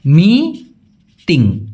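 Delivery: মিটিং said very slowly, light t; drawn-out meeting. Shown as a demonstration. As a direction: hold মিটিং long, softening the t. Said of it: "ওরা কি বলে".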